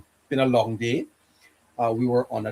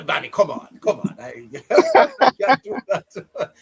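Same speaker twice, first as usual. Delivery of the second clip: laughing; 10 semitones higher, 4 dB louder than usual. Real, not acted. Laughing protest at the joke.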